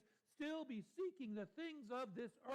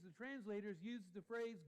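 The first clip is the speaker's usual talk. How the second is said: ordinary talk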